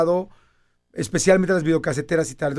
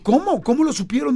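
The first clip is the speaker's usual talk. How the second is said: shocked disbelief; 9 semitones higher than usual. Retold or imitated. Shocked reaction to news.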